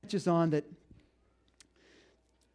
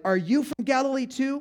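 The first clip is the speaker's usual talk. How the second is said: higher, louder scornful jab; condescending; 8 semitones higher, 6 dB louder than usual. Acted. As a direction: sneer it down at him. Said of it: "Which was extremely condescending".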